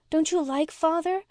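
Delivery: put-on high child's hurt question; high, rising reproach; reproachful. A narrator's reading. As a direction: ask in a small girl's high voice, mildly reproachful. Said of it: "lifting reproachful eyes".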